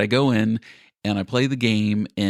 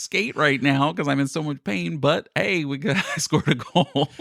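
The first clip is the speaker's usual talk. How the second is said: higher, giggly pained boast; laughing through pain; 7 semitones higher than usual. Retold or imitated. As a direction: laugh it off though hurting. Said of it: "I was like".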